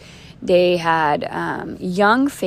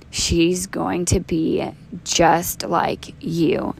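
measured, word-by-word delivery; slow pronouncement; much slower than usual. Retold or imitated. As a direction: deliver it slowly, like a verdict from God. God's slow pronouncement to her dad.

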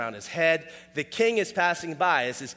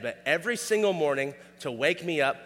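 usual speaking voice

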